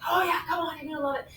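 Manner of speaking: lightly voiced boy coaxing his friend; egging on. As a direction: coax a nervous pal, softly. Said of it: "boys egging each other on".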